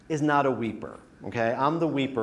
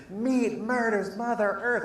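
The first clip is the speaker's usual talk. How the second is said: mock angry vegan chant, high; imitating a preachy vegan in his face; hammed-up activist shouting a slogan; 9 semitones higher than usual. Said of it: "This one guy's in my face".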